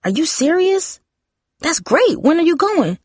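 put-on high excited teenager voice; thrilled. A narrator's reading. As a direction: squeal the questions, thrilled for her sister. Sister thrilled at the news.

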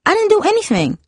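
very high, defensive protest; snapped denial. A narrator's reading. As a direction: snap back in indignant denial. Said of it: "Darcy snapped".

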